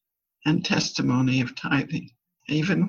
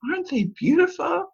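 much higher admiring question; delighted question; 12 semitones higher, faster than usual. Retold or imitated. Grandma admiring the piled pine cones, high delighted voice. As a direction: gush over the pine cones. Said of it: "And I said".

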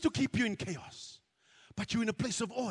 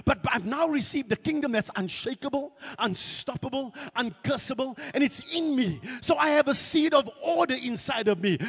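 ordinary talk, nothing marked

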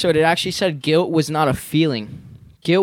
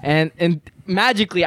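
normal talking voice

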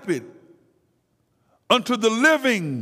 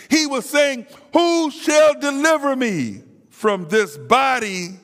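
preaching at full force; 4 semitones higher than usual. Real, not acted. Preacher drives the congregation toward deliverance, full-force preaching. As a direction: build it up like a revival climax.

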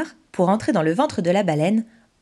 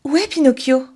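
worried question; 6 semitones higher, 4 dB louder than usual. Acted. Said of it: "s'inquiète alors Geppetto".

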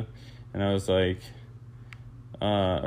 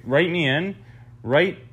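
high, louder call to write in; a mock campaign cheer; rallying call; 7 semitones higher, 3 dB louder than usual. Real, not acted. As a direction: pitch it like a campaign slogan. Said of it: "campaign call to listeners".

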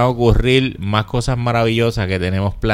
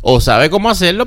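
ordinary talk

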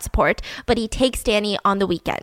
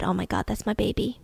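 softer, hushed imitation of a father's awe; tender awe; 9 dB quieter than usual. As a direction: murmur it, falling in love with the baby. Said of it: "and he just said".

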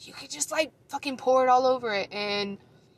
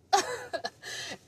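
high-pitched laugh; high giggle; 11 semitones higher, 5 dB quieter than usual. Real, not acted. Laughing at own story.

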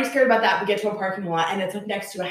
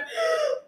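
sharp high gasp before a sob; imitated sobbing gasp; 5 dB quieter than usual. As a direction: mimic the mother's choked gasp. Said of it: "and she just went".

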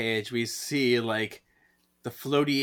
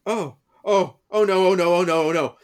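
loud, higher rising panic; panicked oh no; 5 semitones higher, 8 dB louder than usual. Retold or imitated. The group panicking as Prue returns, loud mock panic. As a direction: babble it in alarm. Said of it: "they're like".

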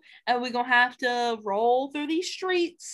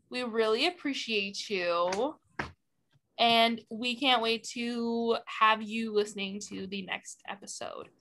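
ordinary delivery

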